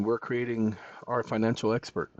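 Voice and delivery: normal talking voice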